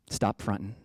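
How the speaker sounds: usual speaking voice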